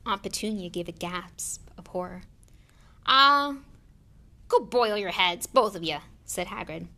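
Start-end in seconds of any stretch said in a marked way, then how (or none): 3.1-6.0 loud, exasperated brush-off; loud dismissal. A narrator's reading. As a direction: dismiss them loudly, fed up. Hagrid dismisses the Dursleys.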